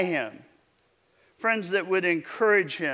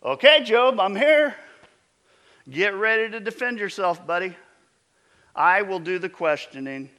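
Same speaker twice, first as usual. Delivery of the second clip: booming, much louder divine voice; God's voice; 4 semitones higher, 7 dB louder than usual. Retold or imitated. God showing up to confront Job, a loud booming challenge. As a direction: boom it out like God arriving. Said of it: "can you imagine that moment".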